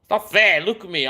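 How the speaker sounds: ordinary talk, nothing marked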